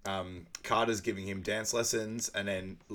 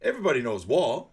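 higher, loud retold protest; mock retort; 5 semitones higher, 9 dB louder than usual. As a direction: imitate insisting in banter. Acted film banter, loud retort.

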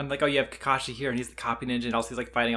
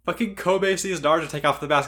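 ordinary delivery

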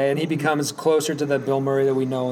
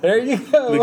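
higher, put-on call; mock cheery send-off; 8 semitones higher than usual. The couple dropping Bob at the doctor's door, mock-cheery call. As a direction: imitate a couple gleefully dropping someone off. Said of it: "they're like".

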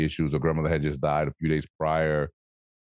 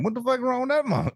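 much higher, squeaky mock disbelief; mocking skeptic. Mocking the dude who distrusts happy people, high and squeaky. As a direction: lampoon the suspicious dude way up high. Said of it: "you got that one dude be like".

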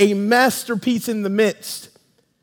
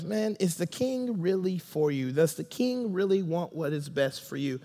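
seed of doubt; 5 semitones lower, 11 dB quieter than usual. Acted.

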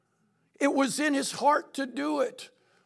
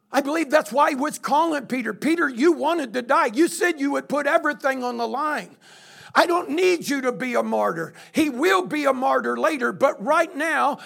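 louder, driving pulpit preaching; preaching at full force; 5 dB louder than usual. Acted. Pastor exhorting his church, preaching loud and fast. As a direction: drive it home to the congregation.

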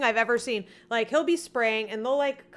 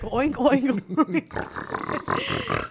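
mock pig noises, trailing into snorts; pig oinks; 3 semitones lower, much slower than usual. Imitating a jury of pigs, oinking. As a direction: oink like a pig at the trough.

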